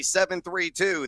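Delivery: ordinary delivery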